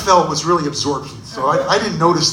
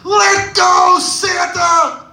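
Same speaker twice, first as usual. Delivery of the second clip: high, loud shrieking demand; shrieking kid; 12 semitones higher, 4 dB louder, much slower than usual. Retold or imitated. Brat shrieks at Santa to let go, high and loud. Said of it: "he shrieked".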